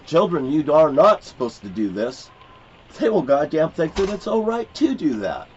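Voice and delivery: usual speaking voice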